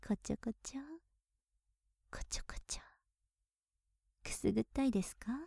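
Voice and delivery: breathy tickling whisper close to the ear; teasing whisper. Acted. Maid teasing her young master, soft whisper.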